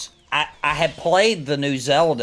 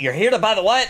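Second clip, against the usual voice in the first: mock-baffled old man, pitched higher; baffled query; 7 semitones higher, 4 dB louder, faster than usual. Old man questioning a stranger through his door, baffled. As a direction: act out puzzled disbelief at the question.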